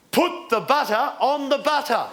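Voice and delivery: put-on slow, high toddler announcement; imitated toddler. Two-year-old daughter standing before dad, slow childlike announcement. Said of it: "stood in front of me and went".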